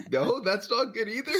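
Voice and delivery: high giggling self-correction; laughing. Real, not acted. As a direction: laugh through the words. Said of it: "like an anime villain".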